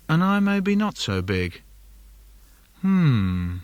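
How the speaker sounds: ordinary talk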